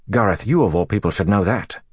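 touch of snappish indignation; snapping. A narrator's reading. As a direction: push back at an old mentor. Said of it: "Gawain snapped".

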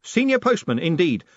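high, emphatic statement with a falling end; confirming. A narrator's reading. Moist granting Grote the promotion, confirming it. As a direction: confirm the title firmly.

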